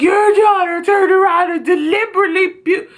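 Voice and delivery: high, huffy complaining voice; mock the babysitter's outrage; indignant babysitter. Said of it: "She was like".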